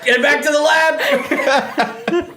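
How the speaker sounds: high, hollered host's call; mock show host. Host ordering contestants back to the lab, mock holler. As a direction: send them off with a big high call.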